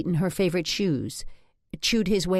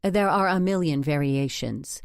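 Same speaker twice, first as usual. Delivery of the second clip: ordinary delivery, nothing marked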